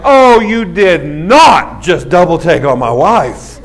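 slow, drawn-out indignant sass; mock outrage, stretched out slow; sassy indignation. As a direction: let each word land, offended. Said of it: "And I'm like".

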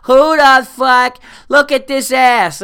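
put-on high, loud shrieking drunk woman; high, loud mock tirade; drunk girl yelling. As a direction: scream it like a drunk girl losing it outside a club.

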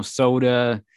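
a slightly Midwestern "soda"; "soda" with a rounded Midwestern long o; Midwestern vowel. Real, not acted. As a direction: let a little Midwestern accent into "soda". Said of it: "the way I said soda is sort of a, a little Midwestern".

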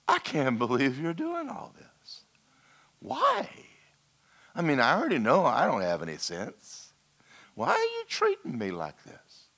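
a quiet, tearful disbelief; emotional prayer. Retold or imitated. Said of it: "I laid in my bed and I went".

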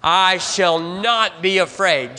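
"I shall not be afraid." said slowly, every word weighted, for the congregation to echo; slow emphatic call. Shown as a demonstration. Pastor leads the congregation in a slow declaration. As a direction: lead them word by word, slow and firm. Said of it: "Say it again".

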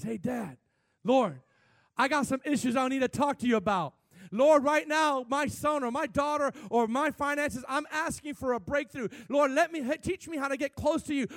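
loud, high, pleading prayer; fervent prayer. Acted. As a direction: cry out to the Lord for a breakthrough. Acted heartfelt prayer to God.

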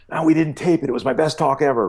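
high-pitched, excited mock lament; retold elated regret. Speaker phoning wife after the talk, excited mock lament. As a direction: imitate gushing after a triumph, pitched well up.

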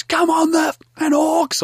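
high-pitched garbled bogan yell; strained, high mock yell without much volume; mock scream. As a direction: imitate a footy fan screaming gibberish. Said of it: "or whatever he's screaming".

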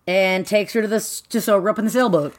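ordinary talk, nothing marked

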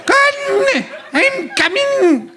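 high, breathy mock Scottish moaning; hammed-up Scottish climax voice; Scottish accent. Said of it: "If it's my Scottish accent".

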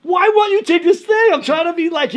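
high, loud mock protest; exasperated arguing. Young player arguing with mentor who refuses thanks, exasperated yell. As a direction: plead at full pitch, imitating your frustrated self. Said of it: "I'm arguing with him".

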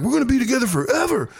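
high, quick and starry-eyed; dreamy kid vow. Retold or imitated. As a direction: gush it like a smitten first grader. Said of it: "you're like".

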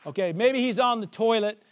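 normal talking voice

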